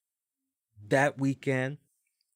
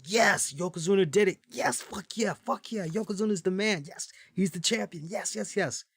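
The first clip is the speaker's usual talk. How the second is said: excited cheering; 7 semitones higher than usual. Retold or imitated. Host reliving his teen self cheering Yokozuna's win, in high excited whoops. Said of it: "I was excited".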